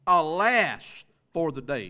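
ALAS loud, drawn out, falling; slow, forceful punch on ALAS; emphatic exclamation. Shown as a demonstration. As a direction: belt out "Alas" like it ends in an exclamation point. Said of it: "See the exclamation point?".

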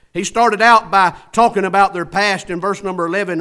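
normal talking voice

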